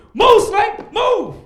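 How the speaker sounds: high, loud panicked yell at a snake. Retold or imitated. Man yelling at a snake in the street, loud panic. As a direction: shoo the snake away, shouting. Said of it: "what you do?".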